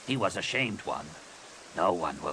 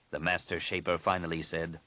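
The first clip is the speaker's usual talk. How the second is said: ordinary delivery, nothing marked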